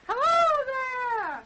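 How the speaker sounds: long, high hail across the water; stretched hail to far-off hunters; calling out. Acted. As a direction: call out loud and slow to a distant boat. Calling across the dark lake.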